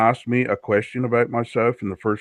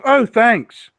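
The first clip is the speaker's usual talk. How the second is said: much higher, louder mock-flattered chirp; mimicking the woman he insulted, bright mock thanks; mock chirpy thanks; 11 semitones higher, 5 dB louder than usual. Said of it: "And she goes".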